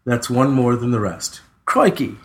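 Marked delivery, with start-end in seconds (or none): 1.6-2.2 Aussie exclamation. Retold or imitated. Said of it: "turn my Aussie accent up to eleven".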